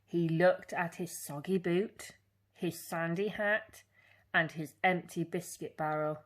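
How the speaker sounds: usual speaking voice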